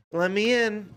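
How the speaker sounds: lightly voiced sleepy plea; pleading. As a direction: imitate a partner begging to cuddle. Fiancée's plea to cuddle, acted.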